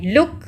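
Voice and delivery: लुक ending quickly; short oo. Shown as a demonstration. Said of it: "जल्दी से ख़त्म हो रहा है".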